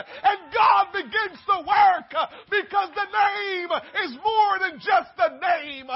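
loud, high, shouted sermon with a cry in it; fiery preaching. Real, not acted. Preacher to congregation, loud fervent preaching.

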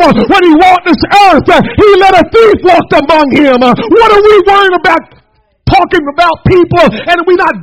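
loud, driving sermon delivery, shouted peaks; full-force preaching. Acted. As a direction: preach it hard to the congregation.

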